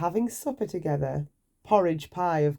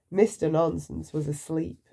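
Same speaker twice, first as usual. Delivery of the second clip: usual speaking voice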